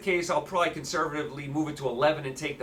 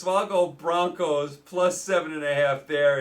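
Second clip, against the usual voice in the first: ordinary talk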